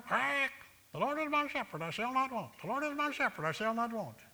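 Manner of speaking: high squawky parrot reciting. Pretend. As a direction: squawk it like a parrot repeating by rote. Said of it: "you could teach a parrot to quote".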